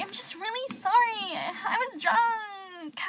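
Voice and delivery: high, slow, crying plea; teary apology. Retold or imitated. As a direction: whimper it out like a drunk sorry friend. Drunk friend's apology retold.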